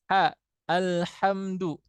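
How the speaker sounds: slow breathy ha in alhamdu, air flowing; hams ha demo. Shown as a demonstration. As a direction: let the air flow on ha, slowly. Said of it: "Contoh misalkan tadi ha". (huruf ha dibaca pelan dengan nafas mengalir)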